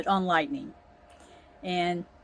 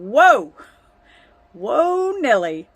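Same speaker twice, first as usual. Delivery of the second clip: startled whoa; 3 semitones higher, 8 dB louder, slower than usual. Retold or imitated. Runaway horse, calling out.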